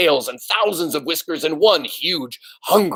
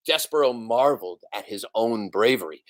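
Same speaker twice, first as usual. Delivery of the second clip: usual speaking voice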